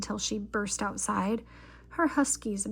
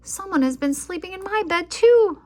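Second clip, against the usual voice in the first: much higher, louder outcry; cry out; 10 semitones higher, 9 dB louder than usual. A narrator's reading. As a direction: cry it out in alarm. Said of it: "Mama Bear cried".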